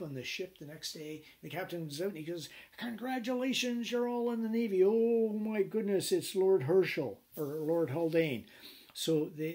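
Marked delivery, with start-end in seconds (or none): 2.8-4.8 high, loud mock announcement; hammed-up captain hailing new recruits; captain greeting pressed men on deck; gloating captain. 4.8-8.5 slow, loud, hammed-up dismay; captain realizing he pressed a lord; mock shocked captain.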